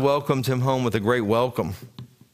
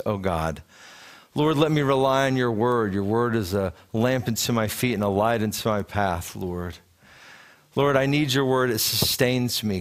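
Jerry Rutkin praying; 4 semitones lower than usual. Retold or imitated. Preacher recalling an old teacher's prayers to God, humble prayer. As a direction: pray lowly, sinking at each address to God. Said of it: "I can hear Jerry Rutkin in my mind speaking such things".

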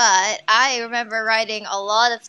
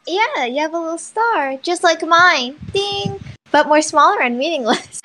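higher, lightly voiced haughty girl; Amity Blight impression; 6 semitones higher than usual. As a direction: tease it like a smug rival. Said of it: "My favorite line from Amity".

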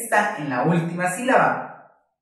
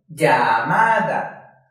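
"Llamada" muy lenta, sílaba a sílaba; dividida por sílabas; 3 semitones lower, 4 dB louder, much slower than usual. Shown as a demonstration. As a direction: stretch out each syllable of "Llamada". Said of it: "Aquí dividimos llamada por sílabas". ("Llamada" separada por sílabas, muy despacio)

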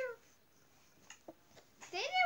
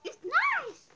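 higher, much louder insisting; loud insistence; 5 semitones higher, 10 dB louder than usual. Real, not acted. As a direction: snap it out emphatically. Grandma repeats herself louder.

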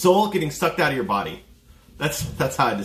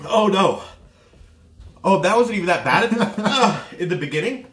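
mouth burning from spicy food; 4 semitones higher, 3 dB louder than usual. Real, not acted. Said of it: "It just gets worse and worse".

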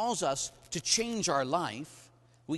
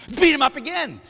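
loud burst; 11 semitones higher, 14 dB louder than usual. Acted. Violent climax of story.

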